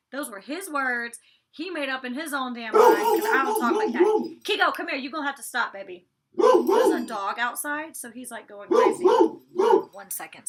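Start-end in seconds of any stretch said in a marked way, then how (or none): 6.4-10.5 raised over barking. Real, not acted. Dog barking at window.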